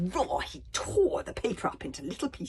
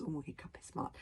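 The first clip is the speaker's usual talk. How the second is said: ordinary delivery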